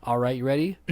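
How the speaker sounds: ordinary talk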